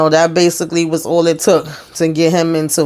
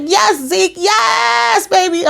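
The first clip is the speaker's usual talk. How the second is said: much higher, hyped-up cheer; cheering him on; 13 semitones higher, much slower than usual. Retold or imitated. Recapper cheering Zeke's outburst from her couch, high and hyped. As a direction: egg him on like a fan yelling at the screen. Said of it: "And I was like".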